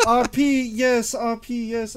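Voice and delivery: high, affected "mm, yes" voice; overspeaking perfect-grammar type. Pretend. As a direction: hum and agree in a high, pompous put-on voice. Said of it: "over speaking, essentially".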